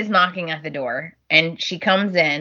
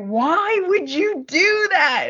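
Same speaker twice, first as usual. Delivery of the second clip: mock disbelief; 10 semitones higher, slower than usual. Liam's shocked reaction voiced.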